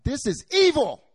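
loud, high indignant cry; angry outburst. Retold or imitated. Teacher fuming as he walks around, loud indignant cry. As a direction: blurt it out, furious. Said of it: "I'm so angry".

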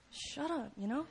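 very soft mock "shut up"; annoyed aside. Annoyed passer-by at someone yelling in public, hushed aside. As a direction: grumble it under your voice.

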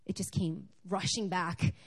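ordinary talk

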